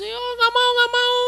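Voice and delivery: put-on high, wailing scared boy; crying child. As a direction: whimper out the refusal. (anak menangis ketakutan, suara tinggi)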